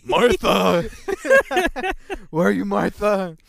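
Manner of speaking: hammed-up cry of a vigilante, half laughing; Batman. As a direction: bellow it in mock torment. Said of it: "you wanna wake up to Batman".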